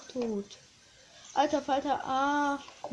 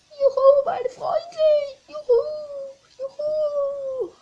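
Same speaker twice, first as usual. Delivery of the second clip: high, loud joyful cheer; excited cheering; 13 semitones higher, 10 dB louder, slower than usual. Acted. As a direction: cheer it out like a happy kid. Cheering character in game. (jubelnd, hoch und laut)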